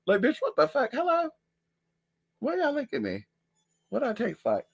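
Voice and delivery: high voice to the dogs; baffled, talk up to the dogs; high pet voice. Real, not acted. Vlogger asking dogs why they lick her, high pet voice.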